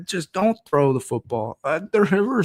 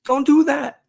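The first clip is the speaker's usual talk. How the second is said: high pleading yell, louder; 12 semitones higher, 5 dB louder than usual. Retold or imitated. Fan yelling at the TV to stop the play, high pleading yell. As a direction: beg the screen not to, voice jumping up high. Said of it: "you're talking to your T V and you're saying".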